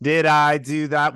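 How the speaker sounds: loud, slow go at Urkel; hammy, drawn-out sitcom catchphrase; Urkel impression. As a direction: belt out the catchphrase, stretched. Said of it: "I think his was better than mine".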